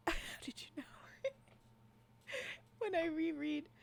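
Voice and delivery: words breaking up with real laughter; helpless laugh-talk. Real, not acted. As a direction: try to carry on through the giggles. Cracking up while trying to continue.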